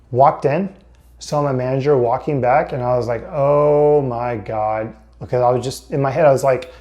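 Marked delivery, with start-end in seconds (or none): 3.3-5.0 slow, drawn-out dread. Retold or imitated. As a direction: drag it out, sinking feeling. Dread seeing manager.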